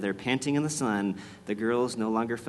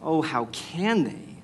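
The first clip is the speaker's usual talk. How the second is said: slow, higher-pitched lament; tearful dismay; 4 semitones higher, slower than usual. A narrator's reading. Lucy watching the enemies jeer at Aslan, tearful dismay. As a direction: draw it out in pained disbelief. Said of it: "tears streaming down her cheeks".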